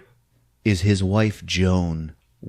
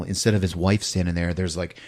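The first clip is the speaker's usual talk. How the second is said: usual speaking voice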